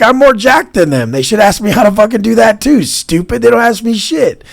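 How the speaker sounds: high, loud retort yelled at commenters; heated shouting. Retold or imitated. Answering online commenters with a loud, high yell. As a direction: shout it back, fired up.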